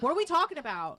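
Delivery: quick Jersey "talking," open aw; fast Jersey accent on "talking". Shown as a demonstration. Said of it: "I will say it like that".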